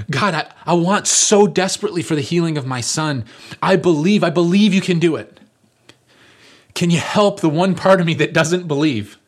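high, loud, pleading prayer; a desperate father crying out to God; desperate plea. Acted. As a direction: beg urgently for your son's healing. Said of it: "he's desperate".

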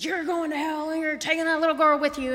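high, loud imitated hollering; retold screaming. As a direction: act out the man screaming at her. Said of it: "he started screaming".

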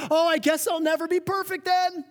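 high, loud martyr whine; mock self-pity. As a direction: throw a hammed-up self-pitying fit. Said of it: "you turn it into a".

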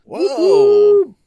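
loud, high whoa of delight; excited whoa. Real, not acted. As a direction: cry out in excitement. Reaction to pencil sharpener prize.

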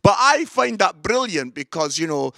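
usual speaking voice

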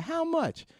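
high, eager women's voice. Retold or imitated. Salon women eager for bags.

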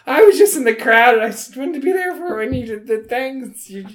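high, plaintive character voice; whiny fan. Acted. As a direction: pitch it up high, pleading your case. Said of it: "She's like".

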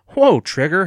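loud, high call to a dog. A narrator's reading. Evan at the window to his barking dog, calling out. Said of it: "Evan called".

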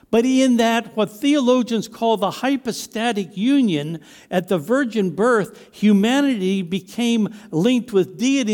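fervent preacher building his case; emphatic preaching. Real, not acted. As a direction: proclaim doctrine with weight on key words. Preaches on Christ's deity at force.

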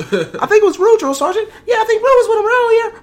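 high, loud, whiny tattling; whiny snitching recruit. Retold or imitated. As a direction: squeal on him like a snitch.

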